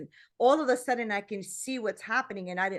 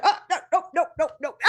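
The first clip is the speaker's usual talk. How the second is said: high, loud rapid-fire refusal; mock-panicked protest, pitched up; alarmed refusal; 7 semitones higher, 4 dB louder, much faster than usual. Woman retells balking at public speaking, in mock alarm.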